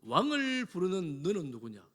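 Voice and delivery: self-important, drawling challenge; haughty commander's challenge. Acted. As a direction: answer like someone acting as king, stretching the words. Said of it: "아브넬이 뭐라고요".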